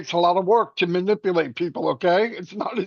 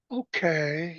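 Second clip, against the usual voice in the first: that voice; 2 semitones lower, 8 dB quieter than usual. Retold or imitated. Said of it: "You know that voice".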